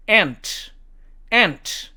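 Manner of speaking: American "aunt", just like "ant"; American demo, "ant"; American accent. Shown as a demonstration. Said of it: "미국식 발음으로는".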